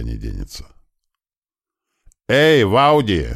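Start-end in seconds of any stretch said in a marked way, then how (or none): 2.3-3.3 slow, loud call, higher; loud shout. A narrator's reading. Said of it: "стараясь как можно громче, крикнул я".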